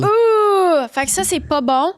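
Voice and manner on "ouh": high, loud startled yelp; surprised ouh. Real, not acted. Friend hearing about the metal ankle, startled ouh.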